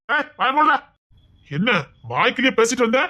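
loud, high angry command; angry shout. Acted. Thug shouting at the girl to shut up, loud and high. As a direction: bark her into silence, loud and high.

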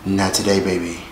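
soft, low, sassy [name]; a light sassy [name] dismissal. Retold or imitated. Vlogger doing [name] to dismiss fans, soft and sassy. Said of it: "In my [name] voice".

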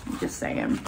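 a tiny whispered throwaway; whispered aside. Real, not acted. Whispered wry aside to viewers about travel. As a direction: drop it under your breath, wry.

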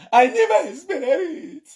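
slow, high mocking imitation with a laugh; mocking her English. Vlogger mocking the woman's English, slow and high. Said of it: "spoil my platform with English".

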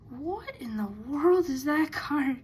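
normal talking voice

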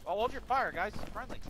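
high urgent cease-fire call; shouting orders over battle. Real, not acted. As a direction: shout to stop the shooting now. Stopping friendly fire mid-fight.

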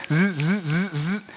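soft buzzing zzz like a vibrating phone; imitated phone buzz. Preacher mimics his phone buzzing with replies, soft buzz. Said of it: "I got responses".

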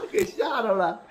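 laughing. Real, not acted. Laugh heard on the line.

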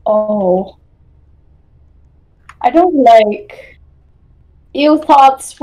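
ordinary talk

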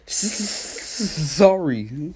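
slow stammered sorry, hissing s; mock stutter. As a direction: stammer it like a snake bite. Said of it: "This man was talking like".

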